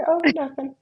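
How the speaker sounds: high, acted-out never mind; mock innocent brush-off. Acting out brushing off puzzled client.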